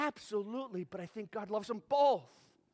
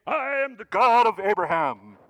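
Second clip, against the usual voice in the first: booming God; 15 dB louder than usual. Retold or imitated. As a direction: boom it out like a distant lofty God. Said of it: "standing up in heaven saying".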